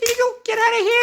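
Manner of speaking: very high loud squeak; high bird voice. Pretend. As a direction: squeak at the eagle. Birds chase eagle.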